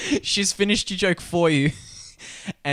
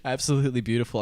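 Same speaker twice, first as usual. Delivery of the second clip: ordinary talk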